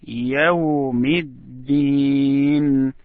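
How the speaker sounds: يوم الدين slowly, with الدين drawn out; stress on the last word. Shown as a demonstration. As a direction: lean on الدين, slow and deliberate. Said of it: "we want to stress on the last word".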